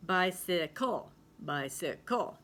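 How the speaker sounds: "bicycle" as bye-si-KUL, weight on the end; stress on final syllable. Shown as a demonstration. Teacher trying another stress, "bicycle" stressed on the final syllable. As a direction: push the last syllable of "bicycle". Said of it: "pronounce it at the end".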